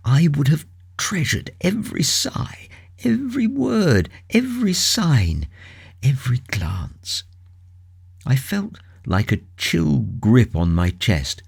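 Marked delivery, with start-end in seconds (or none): 0.1-7.2 high grief sinking to a whisper; grief fading to whisper. Acted. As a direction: let it fade out into a breath.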